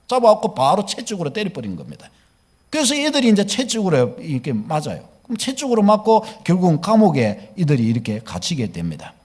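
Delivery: ordinary talk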